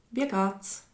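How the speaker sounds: ordinary talk, nothing marked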